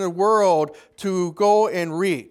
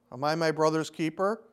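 cynical retort; 5 semitones lower, 7 dB quieter than usual. Retold or imitated. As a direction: lower and softer, dismissive shrug of a question. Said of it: "a very hard-hearted and a very cynical answer".

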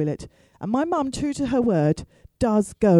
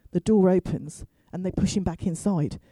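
ordinary delivery

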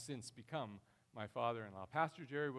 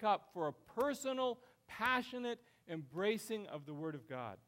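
higher, louder urgent preacher's voice; full-force preaching; 11 semitones higher, 7 dB louder than usual. Acted. Pastor urging church to fill lives with Word, fervent. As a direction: press the point home with fervour.

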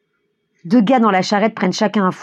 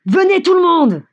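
shouting; 10 semitones higher, 4 dB louder than usual. A narrator's reading. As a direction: call out to the whole crowd, high. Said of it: "crie Mikolka". (cri haut lancé à la foule)

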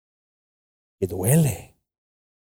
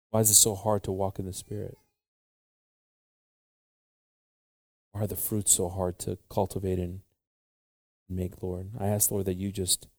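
prayer; 3 semitones lower than usual. Real, not acted. Leads long congregational prayer.

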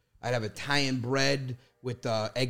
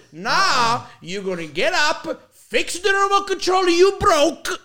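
slow, loud imitation of dad's order; scolding dad; 11 dB louder, slower than usual. Son imitates dad demanding the remote fixed, mock angry. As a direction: climb higher as you lay down the punishment. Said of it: "He goes".

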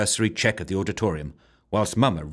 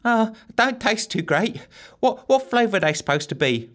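put-on timid girl voice; 13 semitones higher than usual. A narrator's reading. Bracy tastes the drop.

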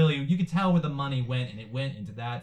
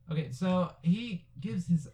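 usual speaking voice

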